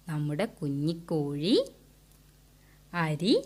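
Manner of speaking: put-on teacher quietly telling the hen story to kids; soft storytelling. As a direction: draw the children in quietly. Narrating story softly to children.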